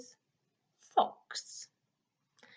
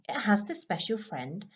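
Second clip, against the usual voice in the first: normal talking voice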